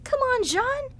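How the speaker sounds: normal talking voice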